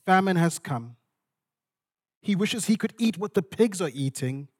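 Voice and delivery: ordinary talk, nothing marked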